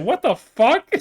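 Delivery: high, loud shocked "what the fuck"; imitating a stunned outburst; shocked exclamation. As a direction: blurt it out in disbelief. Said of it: "She said".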